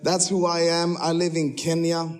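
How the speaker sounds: normal talking voice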